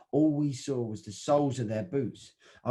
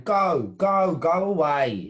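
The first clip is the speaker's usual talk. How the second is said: loud, slow protest yell; shouting; 2 semitones higher, 6 dB louder, slower than usual. Acted. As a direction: drive them off with a long shout. Protesters yelling at police.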